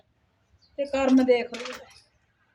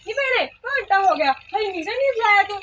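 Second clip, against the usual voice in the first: higher, nagging call from the mistress of the house; bossy employer nagging the maid about the fodder; scolding employer; 7 semitones higher than usual. Acted. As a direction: call out to the maid impatiently, pitched up.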